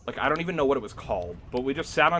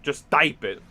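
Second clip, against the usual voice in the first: teacher's stern order; 3 semitones higher, 9 dB louder, slower than usual. Retold or imitated. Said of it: "she'd be like".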